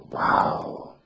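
long breathed-out wow; awed wow. Acted. Young boy hears the musician's tale, awed whispery wow. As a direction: let wonder escape as a whisper.

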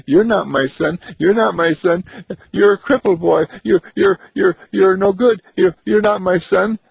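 high, loud, stammering outburst; angry rejection. Acted. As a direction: push him away in distress. Mother rejects the crippled boy.

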